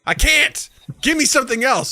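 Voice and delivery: high, emphatic retold rebuttal; acted-out fed-up clerk; exasperated insistence. Arguing back at outraged customer.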